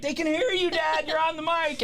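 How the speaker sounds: put-on high kid voice, laughing; little kid. The young son correcting his dad, high kid voice. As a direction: pipe up like a bossy little kid. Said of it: "little nine-year-old".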